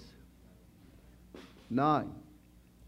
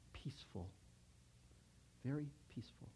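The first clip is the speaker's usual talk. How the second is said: hushed peaceful; 15 dB quieter than usual. Shown as a demonstration. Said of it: "describing the peace he felt".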